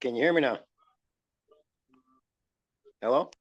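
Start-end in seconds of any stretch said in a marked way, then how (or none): none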